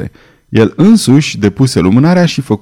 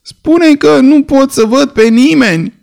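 much higher, whining voice behind a locked door; plaintive voice from within; 14 semitones higher than usual. A narrator's reading. The hidden figure answers through the door, high and plaintive. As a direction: whimper it like a trapped, pitiful creature. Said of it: "cu un ton plângăreț".

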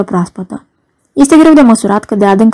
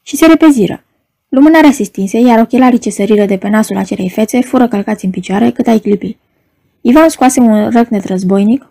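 plain reading voice

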